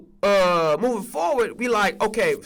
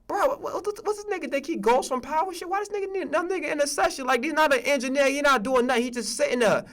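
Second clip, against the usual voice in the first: high, fast, hushed disbelief; muttering to himself in disbelief, voice up high; incredulous inner thought; 6 semitones higher than usual. Retold or imitated. To himself about the stranger in the session, high hushed disbelief. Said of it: "I'm like".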